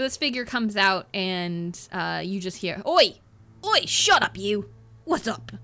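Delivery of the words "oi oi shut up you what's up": gruff stranger shouting. Acted. GM voices figure shouting at dog.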